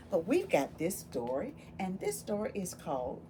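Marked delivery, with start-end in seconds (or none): none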